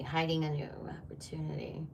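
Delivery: soft secretive whisper. Acted. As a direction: breathe it like a secret. Repeats the phrase as a whisper.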